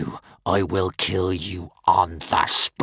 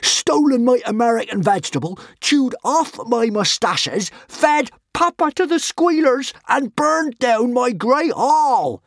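shrieking; 14 semitones higher, 7 dB louder than usual. A narrator's reading. Norbert the Nutjob shrieks his grievances at Hiccup. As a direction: rant like a man at the edge. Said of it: "shrieked Norbert the Nutjob".

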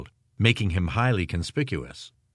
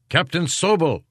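raised, higher-pitched call; 8 semitones higher, 3 dB louder than usual. A narrator's reading. Prankster calls out to the captain, calling out. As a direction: call out to get his attention. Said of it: "a voice called out".

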